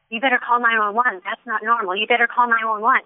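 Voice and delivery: ordinary talk, nothing marked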